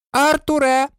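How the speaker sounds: high-pitched call to a husband. A narrator's reading. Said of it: "гукнула місіс Візлі". (високий жіночий оклик)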